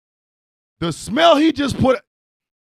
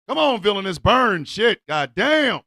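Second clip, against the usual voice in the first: usual speaking voice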